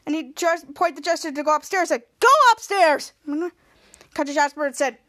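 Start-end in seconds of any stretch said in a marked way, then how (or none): 2.2-3.1 high, loud imitated order; commanding shout. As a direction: order him off sharply. Retold barked order to Jasper.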